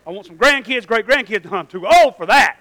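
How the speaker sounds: loud, emphatic objection; indignant protest. Acted. Congregant refusing a new baby, indignant protest. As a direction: object loudly with punched key words.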